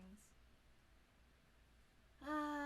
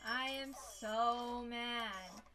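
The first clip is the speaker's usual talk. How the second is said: slow, drawn-out theatrical outrage; mock anger; 3 semitones higher, 6 dB louder, much slower than usual. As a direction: wail your grievance slowly, hammed-up. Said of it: "I'm angry!".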